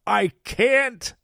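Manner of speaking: loud, high Santa protest with some guilt; tinge of guilt. A narrator's reading. Santa refusing to save the boy, slightly guilty. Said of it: "a tinge of guilt in his voice".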